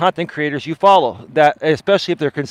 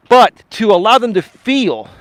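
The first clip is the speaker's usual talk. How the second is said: higher, louder and slow for emphasis; elevated highs; 6 semitones higher, 6 dB louder than usual. Shown as a demonstration. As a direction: lift the voice up on feel to show passion. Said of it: "by elevating the highs".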